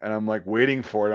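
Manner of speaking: usual speaking voice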